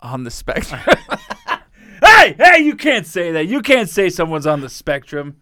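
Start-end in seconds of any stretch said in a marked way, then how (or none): 0.9-1.6 sudden high-pitched laugh; high squealing laugh. Real, not acted. Laughing at his own joke, high squeal. 2.0-5.4 loud, high hammed-up reprimand; mock scolding. Pretending to scold himself for saying it, loud and high.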